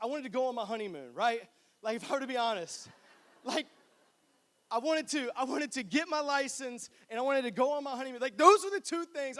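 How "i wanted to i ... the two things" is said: higher, louder, rushing to list them; building eager excitement; excited. Real, not acted.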